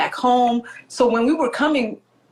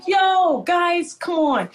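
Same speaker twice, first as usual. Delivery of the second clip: high, playful pleading; mock exasperated appeal; 8 semitones higher, much slower than usual. Child appeals to the family who kept her uninformed, mock exasperated appeal. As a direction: call the family out in mock protest.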